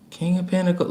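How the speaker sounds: usual speaking voice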